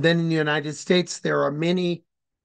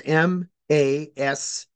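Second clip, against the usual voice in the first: spelling out letters; slower than usual. Shown as a demonstration. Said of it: "I can give you the spelling".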